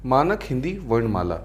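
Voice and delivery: ordinary delivery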